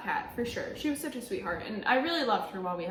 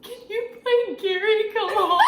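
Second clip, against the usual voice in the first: higher, louder, laughing request; laughing plea; 10 semitones higher, 7 dB louder than usual. Retold or imitated. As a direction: ask through laughter and tears. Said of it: "And Eliza's like".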